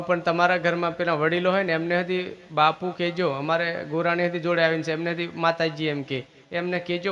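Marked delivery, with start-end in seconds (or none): none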